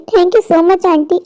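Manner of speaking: ordinary talk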